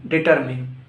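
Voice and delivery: "determine" ending in a short MIN; corrected DETERMINE. Shown as a demonstration. Teacher correcting learners, "determine" said as di-TER-min. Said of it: "Repeat after me".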